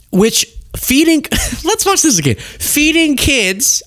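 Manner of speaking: laughing disbelief. Real, not acted.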